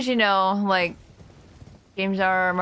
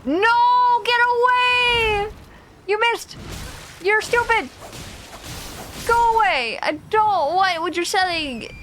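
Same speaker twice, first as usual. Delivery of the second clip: panicked yelling; 13 semitones higher than usual. Real, not acted. Screaming at enemy in game.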